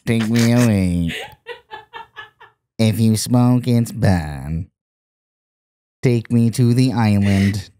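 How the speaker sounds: slow, lightly done robot voice from a smoking ad; slow, even robot throat voice. Retold or imitated. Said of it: "I'm doing impressions".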